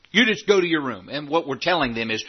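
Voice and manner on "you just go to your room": put-on a higher, mildly stern parent sending a child to their room; lightly voiced scolding parent; mock parent scolding. Parent sending a child off, mild mock scold. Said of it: "the old saying".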